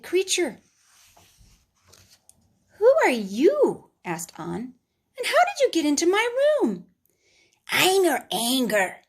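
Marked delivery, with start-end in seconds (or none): 2.8-3.9 put-on surprised child. Boy meets creature. 5.2-6.9 put-on high, fast child voice, alarmed; startled boy. Boy confronting a creature in his bedroom, high startled child voice. 7.7-9.0 anger creature. Acted.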